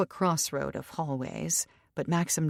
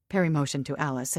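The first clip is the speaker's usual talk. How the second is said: plain reading voice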